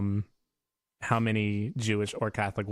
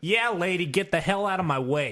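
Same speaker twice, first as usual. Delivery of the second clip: put-on New Yorker accent; 8 semitones higher than usual. New York mailman telling lady to move, New York accent. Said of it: "I did a New Yorker accent".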